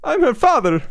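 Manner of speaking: put-on squeaky high Italian accent voice; high-pitched Italian dad voice. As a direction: play a goofy high-voiced Italian father. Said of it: "your Italian accent and your, your high-pitched voice".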